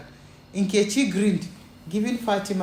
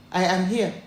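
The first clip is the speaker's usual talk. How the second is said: ordinary delivery, nothing marked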